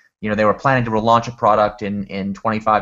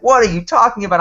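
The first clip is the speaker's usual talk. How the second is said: higher, louder mock outburst; incredulous; 10 semitones higher, 5 dB louder than usual. Lawyer reacting to the team's phone request, mock incredulous. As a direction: imitate a baffled lawyer cutting in.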